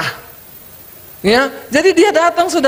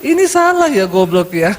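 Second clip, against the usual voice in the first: insult while smiling; 5 semitones lower than usual. Acted. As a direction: correct them with a smile, insult tucked in low. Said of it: "Ini enggak".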